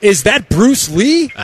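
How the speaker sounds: imitating an excited co-host, pitch jumping up; high, drawn-out mock excitement; excited question. Co-host spotting Bruce Lee at the party, mock excited. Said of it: "gets all excited and goes".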